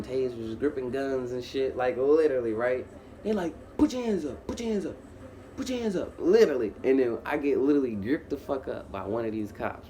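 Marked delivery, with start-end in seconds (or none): 3.8-6.1 high-pitched imitation of a cop's command; mock police order. Cops ordering the teller to surrender, urgent mock command. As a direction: bark it like an imitated cop, pitched up.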